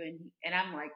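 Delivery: ordinary talk, nothing marked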